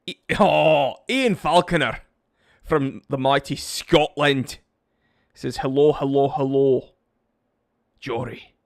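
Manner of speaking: hearty, loud put-on Scottish accent. Streamer greeting a Scottish viewer by tradition, in a Scottish accent. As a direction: roll it out in a hammed-up Scottish accent. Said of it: "we do the accent".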